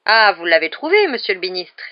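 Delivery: loud opening exclamation, then easing; exclaiming. A narrator's reading. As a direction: exclaim as you greet the minister. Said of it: "s'exclama-t-il".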